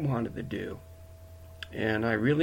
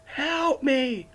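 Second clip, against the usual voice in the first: crying out; 10 semitones higher than usual. Retold or imitated. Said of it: "just crying out to Heavenly Father".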